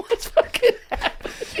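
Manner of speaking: high, laughing incredulity; cracking up in disbelief; laughing in baffled shock. Retold or imitated. Incredulous retold reaction, laughing.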